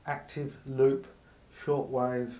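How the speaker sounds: normal talking voice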